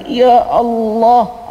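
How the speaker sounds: a suffering prophet begins to cry out; drawn-out sorrowful Ya Allah; anguished cry to God. Acted. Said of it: "Dia baru nak angkat suara".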